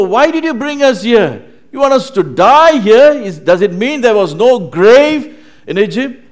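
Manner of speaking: high, loud outraged protest; mocking complaint. Israelites blaming Moses for bringing them here, mocking complaint. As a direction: hurl accusing questions at full volume. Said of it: "they were making fun of him and saying".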